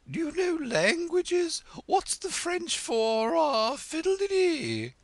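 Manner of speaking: high, loud, slow haughty queen; imperious Red Queen. Acted. Red Queen testing Alice on French, high and haughty. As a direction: quiz her grandly, drawing out fiddle-de-dee.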